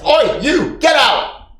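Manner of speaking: loud, high barkeep yell; shouting. A narrator's reading. Barkeep yells at a patron to leave. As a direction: throw someone out of the bar at full voice. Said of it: "and starts shouting".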